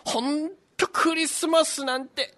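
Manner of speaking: put-on creaky wise old man. Santa grumbles while flying.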